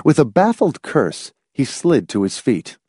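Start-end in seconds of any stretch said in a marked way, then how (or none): none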